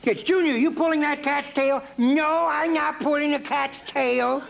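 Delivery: put-on high, bratty little kid voice; Junior the Mean Little Kid. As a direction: comedian doing his bratty kid character. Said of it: "Junior says".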